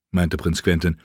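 plain reading voice

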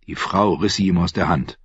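ordinary delivery, nothing marked

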